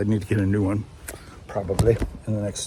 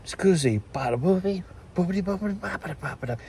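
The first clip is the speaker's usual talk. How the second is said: high, fast, soft gibberish Italian; mock fake Italian; 7 semitones higher, 5 dB quieter, faster than usual. Vlogger retelling Peter's babble, high and quick. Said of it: "he goes".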